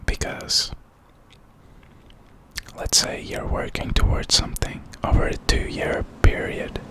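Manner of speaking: usual speaking voice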